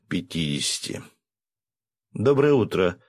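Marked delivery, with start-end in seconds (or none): 2.1-3.0 громко и звонко окликнуть; позвать прохожего парня; окликая. A narrator's reading. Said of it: "окликнула Василиса". (громкий оклик прохожему)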